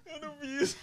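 high squeaky words through tears of laughter; crying with laughter; laughing. Real, not acted. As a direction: barely get it out for laughing. Laughing while listening.

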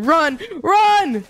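frantic shout to flee; yelling. Real, not acted. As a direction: yell it in a panic. Fleeing a whole other team.

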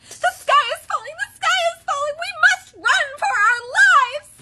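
very high, loud panicked chick; Chicken Little. A narrator's reading. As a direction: cry the alarm in a frantic squeal. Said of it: "Chicken Little panted".

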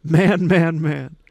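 loud repeated cries, easing on the last; shouted. A narrator's reading. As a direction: shout the word over and over. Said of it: "shouted Robert".

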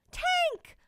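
very high squeal of his name. Acted. Friends spotting Tank at night, very high squeal. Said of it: "Voices squealed".

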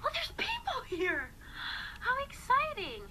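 high breathy surprised whisper; excited whisper. Real, not acted. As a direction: gasp it out, thrilled. Surprised at viewers joining.

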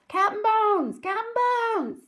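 high, long-held hollers; crew's cry. Acted. Pirates take up the cry for their captain, hollering. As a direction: call it out loud, pitched high. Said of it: "took up the cry".

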